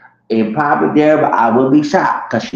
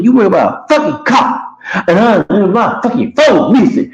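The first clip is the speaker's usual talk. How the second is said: angry yelling; 8 semitones higher than usual. Retold or imitated. Dude yelling about his phone.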